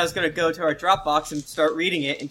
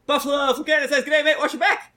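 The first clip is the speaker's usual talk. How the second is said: raised-pitch, punchy review read; high hyped reading; 7 semitones higher, much faster than usual. Acted. Host reading a Canadian listener's review, high and hyped. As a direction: push the whole line up high.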